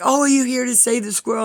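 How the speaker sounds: high, quick eager question; eager bystander. Retold or imitated. Woman greeting the squirrel rescuers, eager and high.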